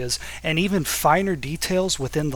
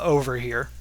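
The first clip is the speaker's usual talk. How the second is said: ordinary talk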